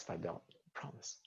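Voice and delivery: soft breathy whisper. Real, not acted. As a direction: whisper it like a secret. Playful promise to a viewer.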